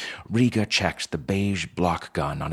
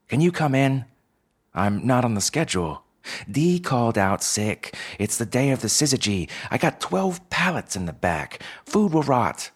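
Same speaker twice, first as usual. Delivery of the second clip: urgent pleading; 6 semitones higher than usual. Acted. Boss pleads urgently on phone.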